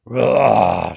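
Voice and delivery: low, loud drawn-out roar; deep storybook monster growl; dinosaur roar. A narrator's reading. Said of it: "said the dinosaur".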